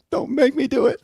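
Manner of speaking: very high-pitched mock little-sister plea; imitating sister. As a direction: squeal it like a panicked kid sister. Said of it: "she would just go like".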